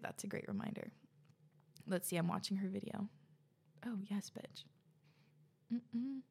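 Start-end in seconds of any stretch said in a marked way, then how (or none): 3.9-6.2 soft delighted whisper. Real, not acted. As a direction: breathe it out under your breath. Reacting while watching her video.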